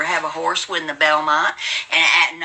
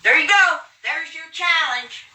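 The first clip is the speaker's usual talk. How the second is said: triumphant taunt; 9 semitones higher, slower than usual. Real, not acted. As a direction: crow the win at them. Taunting viewers after jumping jacks.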